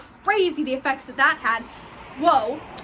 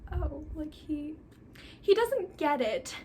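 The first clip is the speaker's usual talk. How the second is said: much softer, trailing into disappointment; crushed and deflated; 6 dB quieter, slower than usual. Retold or imitated. Heartbroken Clary after Jace rejects her, much softer. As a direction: let the air out of her, quietly defeated.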